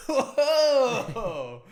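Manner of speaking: very high, loud startled whoa; mock shock. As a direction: yell it as if taken aback. Mock reaction to the Scottish greeting.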